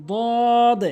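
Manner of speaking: "দদ" long, tongue edge on upper gum; correct pronunciation. Shown as a demonstration. Teacher models the Arabic letter, correct long "দদ". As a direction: draw out "দদ" with a heavy first d. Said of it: "সঠিক উচ্চারণ".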